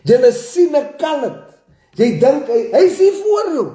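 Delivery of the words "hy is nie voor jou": sudden much higher, louder cry; heated outburst. Real, not acted. Defending McKenzie to the spinners, heated outburst. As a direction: burst out in protest.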